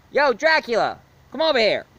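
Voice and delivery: high, loud call across the room. Acted. Reviewer calling Dracula over, high loud call. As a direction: shout for him across the house. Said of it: "I'll ask somebody I know".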